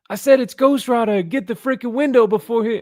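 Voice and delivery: high, loud apartment guy yelling; hammed-up insistent bystander; mock shouting neighbor. Neighbor yells at his buddy to get to the window, high and loud. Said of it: "the guy in the apartment's like".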